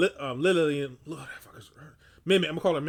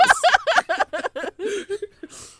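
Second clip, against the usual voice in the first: shrieking laugh; high loud laugh; 8 dB louder than usual. Real, not acted. After the rumor joke, high loud laugh.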